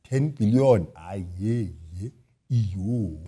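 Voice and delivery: low, soft, far-off mumbling minister; a minister nervously agreeing to ten billion; Pravin Gordhan. Retold or imitated. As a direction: mumble it low and reluctant like a worried finance minister. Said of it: "Pravin Gordhan is going".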